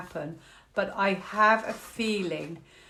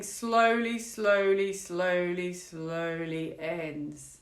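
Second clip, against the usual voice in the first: drawn-out fade; much slower than usual. Shown as a demonstration. Repeats slowly, drawing it out.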